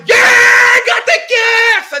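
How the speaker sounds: high, loud thrilled yell; excited kids yelling. Acted. Kids on Christmas morning spotting the presents, shrieking. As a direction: scream it like a kid seeing presents.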